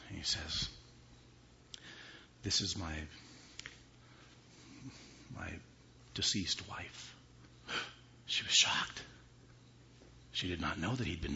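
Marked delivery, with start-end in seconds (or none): 2.4-7.2 a husband quietly naming his dead wife; hushed husband. Acted. 8.3-9.0 breathy hush; a storyteller whispering the twist; whispered. A narrator's reading. Whispered narration of shock.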